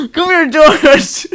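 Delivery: high, loud, slow laughing coo; mock dog-owner call. Mocking owners who give dogs people names, high slow coo. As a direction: drag it out like a doting owner.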